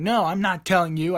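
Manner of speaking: defensive. Retold or imitated. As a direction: dig in like a kid talking back. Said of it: "I start getting defensive".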